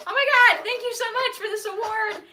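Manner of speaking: breathless, thrilled thanks; acceptance speech. Real, not acted. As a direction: gush with real excitement. Surprised winner accepting award.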